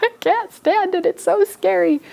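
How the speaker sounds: high-pitched, hammed-up whimpering panic; scared ghost. Teacher as the scared ghost who can't bear it, high panic. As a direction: wail it high, as if overcome with fright. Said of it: "looks kind of scared".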